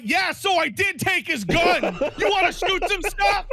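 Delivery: high, laughing mock shout; gleefully offering trouble through laughter; laughing as the reckless friend; laughing high Chloe. Voicing Chloe laughing about the gun.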